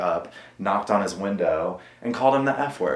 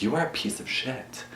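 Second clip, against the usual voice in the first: self-scolding; 4 semitones higher, 8 dB quieter than usual. Retold or imitated. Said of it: "I was calling myself that".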